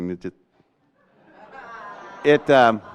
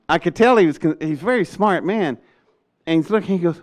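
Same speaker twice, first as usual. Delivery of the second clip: usual speaking voice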